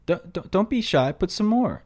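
a quick New York accent impression of the meme; imitating New York accent. Imitating the New York girl meme in a New York accent. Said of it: "with her New York accent".

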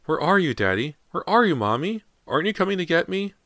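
put-on small ghostly child calling for parents; little child's voice calling for Daddy and Mommy; tiny child voice. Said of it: "a tiny voice".

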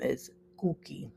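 Cookie said softly with a Spanish accent. Shown as a demonstration. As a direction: quietly say Cookie the Spanish way, Kuki. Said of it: "with the accent".

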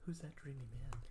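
mock-dreamy, hushed swoon; smitten murmur. Imagined librarian hearing the podcast, hushed swooning. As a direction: softly play the admiring listener.

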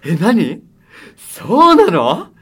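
high, loud, slow mock astonishment; hammed-up surprise. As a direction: gasp as if the news is shocking. 投稿にふざけて大げさに驚く. (大げさにわざと驚く)

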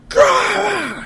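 sudden high-pitched angry yell of disgust; frustrated grunt. Retold or imitated. Imitating a receiver flinging his fist in disgust, high yelp. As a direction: blurt out a pained, squeaky growl.

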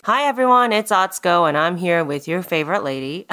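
ordinary talk

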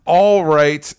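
usual speaking voice

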